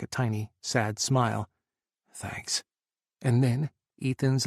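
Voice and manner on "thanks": breathy, much softer whisper; whispered thanks. A narrator's reading. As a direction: barely voice the thanks. Soft thanks with a sad smile.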